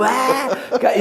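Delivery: long, high-pitched comic yell; hammed-up obedient scream with laughter; mock yell. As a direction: yell on cue in a silly high voice.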